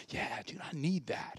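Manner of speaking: very soft, near-whispered aside; barely voiced, secretly envious; hushed coveting. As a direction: murmur it under the breath. Retold envy of electrician's gadgets.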